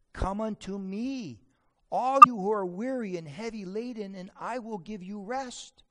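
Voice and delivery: rising, forceful preaching, then trailing off; fervent preaching. Acted. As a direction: build the promise up strongly, then ease it down. Preacher quoting Jesus with force.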